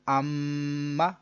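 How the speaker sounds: अम्मा with the म hummed through the nose for about a second; held ghunna. Shown as a demonstration. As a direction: hold the म of अम्मा as a long nasal hum. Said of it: "ये जो मैं रुक रहा हूँ". (अम्मा - म पर एक अलिफ़ तक गुन्ना)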